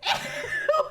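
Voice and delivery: high burst of laughter; sudden high-pitched laugh; incredulous laugh. Real, not acted. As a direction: crack up helplessly. Cracking up at a headline.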